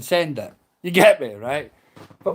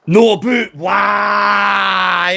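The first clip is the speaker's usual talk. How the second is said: slow, stretched anguished yell; drawn-out shout; 3 dB louder, much slower than usual. Real, not acted. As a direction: drag the words into a shout.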